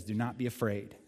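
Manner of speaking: Jesus comforting terrified disciples, softly; gentle reassurance. Acted.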